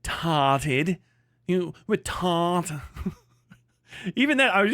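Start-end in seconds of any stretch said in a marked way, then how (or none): 0.0-1.0 "tarted" with a dropped Australian R; "tarted" with a long open "ah"; mock Australian pronunciation. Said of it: "the way you Aussies sound when you pronounce it". 2.0-2.7 "tart" drawn out, R dropped; "tart" with a long open "ah"; mock Australian pronunciation. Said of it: "the way you Aussies sound when you pronounce it".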